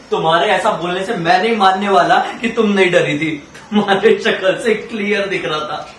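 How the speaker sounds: laughing rebuttal. Acted. Teasing rebuttal in skit take.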